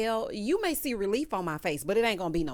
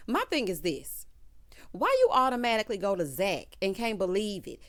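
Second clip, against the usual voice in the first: ordinary talk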